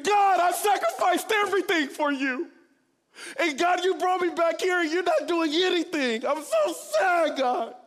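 loud, high, anguished shout near tears; crying out to God. Retold or imitated. Punching the countertop at his parents' house, shouting through tears. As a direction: cry it out in raw grief. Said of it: "punching the marble countertop crying".

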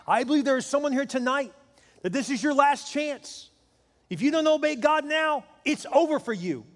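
high, emphatic North Carolina preaching; Billy Graham. Retold or imitated. Preacher warns someone in the crowd, high urgent North Carolina accent. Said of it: "in that great North Carolina accent that he has".

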